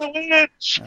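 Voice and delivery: high mock mob shout; angry mob echo. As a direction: join the mob, hammed up. Echoing the mob cry.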